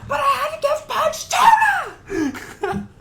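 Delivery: put-on high, slow shouted child voice; Lilo impression. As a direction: yell it like a little girl. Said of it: "other voice impressions".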